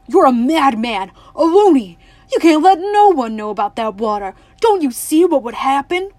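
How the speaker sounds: loud, raging shout; furious shouting. A narrator's reading. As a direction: scream it at a madman. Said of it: "Tuck cried".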